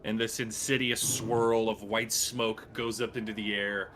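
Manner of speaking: ordinary talk, nothing marked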